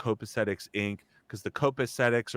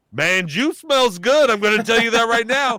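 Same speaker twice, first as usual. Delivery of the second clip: Hijinks; 11 semitones higher, 9 dB louder than usual. Retold or imitated. Comedian praises a cologne gift backstage, loud high impression. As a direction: imitate a friend declaring it emphatically, pitched up. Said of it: "Hijinks was just like".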